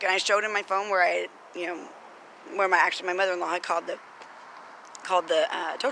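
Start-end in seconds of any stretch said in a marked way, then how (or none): none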